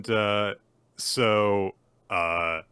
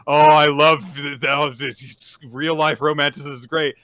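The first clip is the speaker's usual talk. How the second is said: loud, higher, stumbling mock gush; gushing imitation; 6 semitones higher, 13 dB louder than usual. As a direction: imitate a romantic too thrilled to finish. Imitates Becky gushing over romance.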